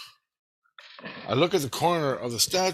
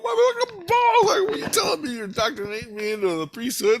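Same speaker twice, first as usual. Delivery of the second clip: incredulous outrage; 11 semitones higher, 4 dB louder, faster than usual. Retold or imitated. Outraged at losing his balls.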